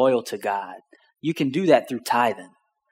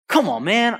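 mildly aggravated, higher-pitched plea; 8 semitones higher than usual. Retold or imitated. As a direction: grumble it with rising impatience. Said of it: "I'm getting aggravated".

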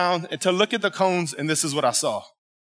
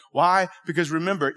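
ordinary delivery

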